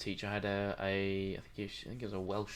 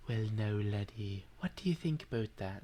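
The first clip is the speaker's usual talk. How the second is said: put-on Irish accent. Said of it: "That's an Irish accent".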